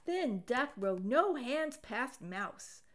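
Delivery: usual speaking voice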